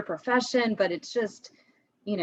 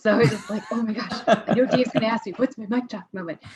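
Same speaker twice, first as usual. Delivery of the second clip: laughing; 3 semitones higher, 4 dB louder than usual. Retold or imitated. Laughing at her own anticipation.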